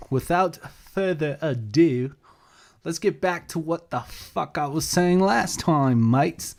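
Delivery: lightly put-on Australian twang on mates; a hint of Australian accent; mock Australian accent. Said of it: "I can't really do an Australian accent".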